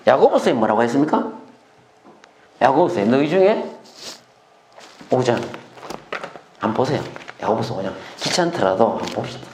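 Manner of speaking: ordinary talk, nothing marked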